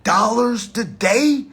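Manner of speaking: lightly voiced, excited worker asking about hours; imitating eager worker. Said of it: "That say, Yo".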